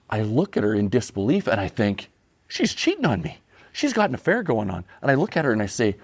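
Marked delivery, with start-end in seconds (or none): 2.5-4.8 high-pitched mock panic, voice jumping up; panicked thought. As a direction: act out a sudden jealous alarm. Panicked inner thought retold.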